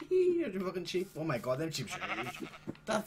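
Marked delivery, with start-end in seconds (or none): 0.1-0.4 sudden high squeaky laugh; high giggle. Real, not acted. Player laughing at sheep, high squeaky giggle. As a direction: let out a loud high hee. Laugh after calling chickens.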